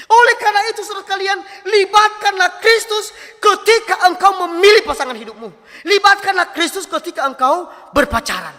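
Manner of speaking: high, loud exhortation; forceful preaching. Real, not acted. Preacher urging youth to involve Christ in dating, forceful exhortation. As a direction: urge the congregation at full force.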